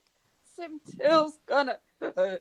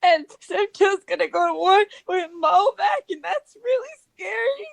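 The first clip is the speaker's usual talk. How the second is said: tearful worrying; 2 semitones higher than usual. Acted. Crying fighter lists fears to friends, tearful. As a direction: pile up worries through tears.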